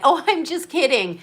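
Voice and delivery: lightly voiced mother taking back a dig; mocking mom. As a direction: toss it off like a fake apology. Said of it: "the sniping comment".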